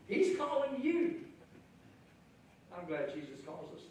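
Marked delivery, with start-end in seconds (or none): 0.1-1.2 higher, louder, slowing at the end; eager encouragement. Retold or imitated. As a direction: rouse the blind man with good news. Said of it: "they say".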